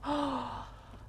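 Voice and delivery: sharp admiring gasp; delighted gasp. Real, not acted. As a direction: gasp in awe. Gasps at bathroom.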